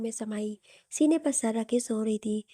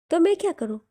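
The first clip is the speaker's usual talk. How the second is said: high, louder sharp comeback; exasperated retort; 6 semitones higher, 5 dB louder than usual. Acted. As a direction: throw it back at him.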